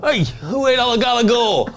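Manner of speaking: high, loud, hammed-up Jersey mobster demand; Tony Soprano impression. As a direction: bellow at the fridge like a mob boss. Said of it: "Tony Soprano's like".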